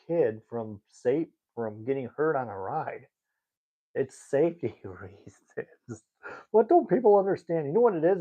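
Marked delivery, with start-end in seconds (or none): 3.9-6.0 whispered with a little laugh; slow breathy whisper of the obvious reason; half-laughing whisper about safety rules; whispered aside. Real, not acted. Aside mid-rant. 6.5-8.2 higher and louder exasperation; real exasperated high outburst. Real, not acted. Exasperated rant about customers.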